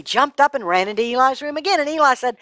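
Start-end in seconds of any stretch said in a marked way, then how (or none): none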